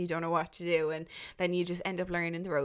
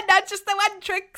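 laughing; 13 semitones higher, 8 dB louder than usual. Real, not acted. Teasing, laughing, repeating.

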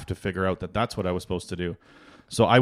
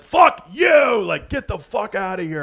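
screaming mock rage, much louder and higher; furious yell; 9 dB louder than usual. Dad yelling at kids interrupting his tiling, furious. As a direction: blow up, hammed-up fury at the kids. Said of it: "I'd be like".

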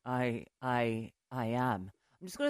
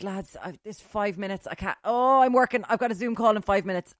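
flustered; 5 semitones higher, 7 dB louder than usual. Retold or imitated. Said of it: "I'm sure they were like".

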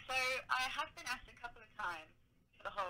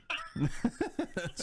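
embarrassed laugh; 8 semitones higher, 4 dB louder than usual. Real, not acted. Laughing after a crazy story.

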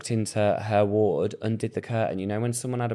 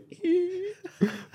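imitated cackle; 5 dB quieter than usual. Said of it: "little cackling in the corner".

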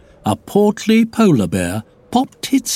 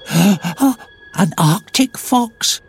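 slow breathless gasp of wonder; 6 semitones higher, much slower than usual. Acted. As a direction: gasp it out in awe. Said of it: "gasped Paula".

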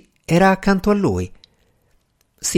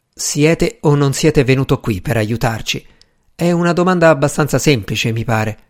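ordinary delivery

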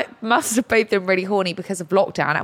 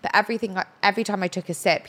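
ordinary talk

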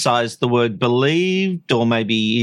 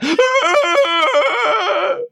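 shrieking laugh at own joke; high burst of laughter. Real, not acted.

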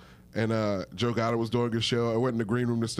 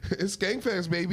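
wine mom; 6 semitones higher than usual. Acted. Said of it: "Why'd you say that like a wine mom?".

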